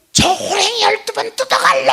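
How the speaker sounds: very high, loud yelled curse; mother shouting a curse at her runaway son; mother yelling after him. Retold or imitated. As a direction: hurl it after the fleeing boy. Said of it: "뒤에다 대고 뭐라 그러냐면".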